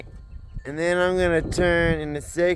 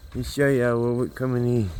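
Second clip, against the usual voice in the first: ordinary delivery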